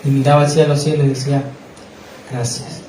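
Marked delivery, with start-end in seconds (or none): 2.3-2.8 whispered thanks. Retold or imitated. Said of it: "Y lloraba".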